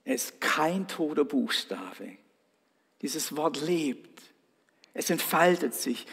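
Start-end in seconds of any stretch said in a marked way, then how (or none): none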